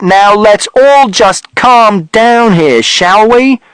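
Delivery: high, loud, slow shout to calm them; forceful attempt to restore order; Mister Shears shouting. A narrator's reading. Mister Shears shouting for everyone to calm down. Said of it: "And Mister Shears shouted".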